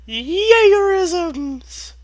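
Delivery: a very high, loud squeal of glee; squealed cheer. Acted. Reader hamming up a gleeful cheer, very high and loud. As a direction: squeal it like a delighted cartoon pony.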